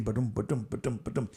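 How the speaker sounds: low soft heartbeat ba-dum; heartbeat imitation. Imitating his pounding heartbeat for the listeners. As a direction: mimic a thudding pulse, low and soft.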